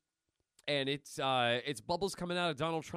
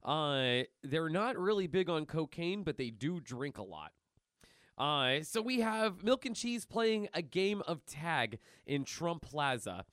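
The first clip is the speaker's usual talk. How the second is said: ordinary delivery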